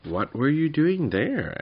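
high, loud, slow astonishment; astonished question. A narrator's reading. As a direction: stretch the question out in surprise.

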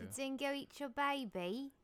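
slow, high, hammed-up Aussie; exaggerated Australian, vowels stretched; mock Australian accent. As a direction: drawl it out in a bad Australian accent. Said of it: "mock their accent".

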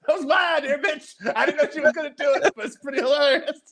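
high, loud voice through laughter; squeaky, gleeful laughing talk. Real, not acted. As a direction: blurt the confession while cracking up. Laughing through own inner thought.

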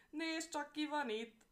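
high, loud gloating shout; triumphant call. Acted. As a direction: crow it out from far above. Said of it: "kiáltott le a nyúl".

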